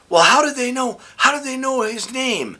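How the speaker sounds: high-pitched mock protest of a mom; lightly acted high mom voice; imitated baffled mother. Acting out mom's baffled protest.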